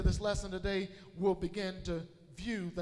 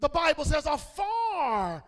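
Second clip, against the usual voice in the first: loud, higher preaching with stretched key words; forceful preaching; 7 semitones higher, 8 dB louder, slower than usual. Real, not acted. Preacher warning church about lip-service love, loud forceful preaching. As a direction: drive it home to the congregation, loudly.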